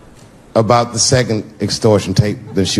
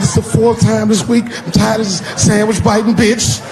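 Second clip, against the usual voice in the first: put-on high whining boy's complaint; whiny kid; 9 semitones higher than usual. Son complaining to dad, high whine.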